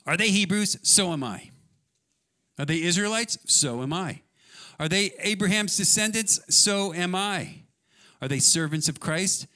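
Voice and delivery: high, ringing rhetorical questions and answers; forceful scripture reading. Acted. Preacher voicing Paul against his rivals, forceful challenge. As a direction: fire out each challenge and answer it boldly.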